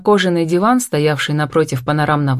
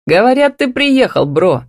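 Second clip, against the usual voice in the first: high, lifted, joyful phone hello; joyful greeting; 7 semitones higher, 4 dB louder than usual. A narrator's reading. Man phones his friend back in town, joyful. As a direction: greet your buddy gladly, pitched up and a bit louder. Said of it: "радостно заявил в трубку".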